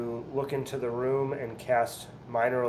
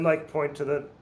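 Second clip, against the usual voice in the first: normal talking voice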